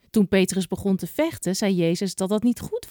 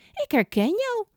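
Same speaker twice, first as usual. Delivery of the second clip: put-on much higher girl's voice, pointing him out; 14 semitones higher than usual. A narrator's reading. Girl accusing Petrus, in a much higher voice. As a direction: play the girl who recognizes him. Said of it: "zegt ze".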